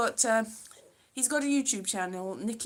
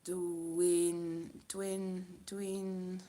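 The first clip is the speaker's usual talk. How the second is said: halting Welsh attempts, quiet and unsure; trailing off, trying to remember; groping for words; 3 semitones lower, 8 dB quieter, much slower than usual. Real, not acted. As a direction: search for the word aloud. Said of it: "I've forgotten".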